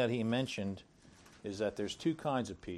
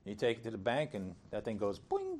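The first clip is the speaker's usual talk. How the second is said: usual speaking voice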